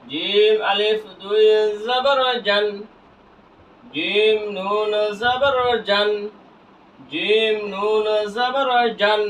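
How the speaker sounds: slow, evenly stretched syllables; measured recitation drill; drawn-out spelling. Shown as a demonstration. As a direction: hold each letter name long.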